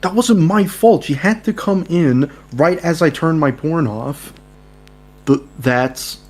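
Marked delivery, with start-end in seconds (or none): 0.0-3.1 high loud defensive protest; flustered protest. Acted. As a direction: blurt it out defensively. Caught character protests.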